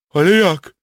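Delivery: high, loud astonished question; amazed. Acted. Captured soldier hears of escape home, amazed. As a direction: blurt it out in amazement. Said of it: "zdumiał się Klaus".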